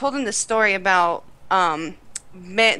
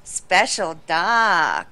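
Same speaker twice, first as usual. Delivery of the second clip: put-on drawn-out accented punchline, pitch sinking; Miss Swan; 5 semitones lower, much slower than usual. Sketch vendor explaining the price, slow mock accent. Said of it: "Miss Swan from Mad T V when she's like".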